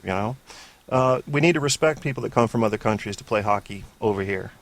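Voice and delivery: normal talking voice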